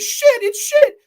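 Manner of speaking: loud, very high-pitched wail; panicked cry. Acted. Allie answering her attacker, panicked cry.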